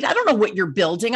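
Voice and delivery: ordinary talk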